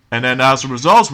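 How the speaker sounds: ordinary talk, nothing marked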